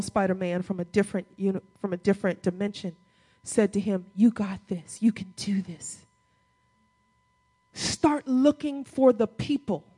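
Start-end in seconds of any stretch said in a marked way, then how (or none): 4.1-6.0 hushed, whispery reassurance. Retold or imitated. Peter Parker encouraging Miles, in a hushed half-whisper.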